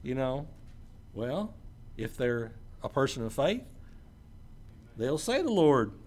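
ordinary delivery

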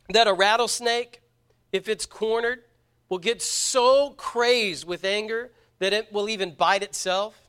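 usual speaking voice

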